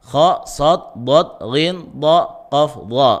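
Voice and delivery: "Kha, sad, dad, ghin, dha, qaf, dha" one by one, each dark and heavy; "Kha, sad, dad" and the rest as separate thick, emphatic sounds; thick letter names. Shown as a demonstration. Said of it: "ketika membacanya harus tebal".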